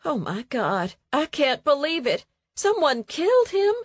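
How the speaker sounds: Oh my God, climbing in disbelief; shocked Evelyn. Acted. Evelyn learning Bug was killed, rising shocked voice.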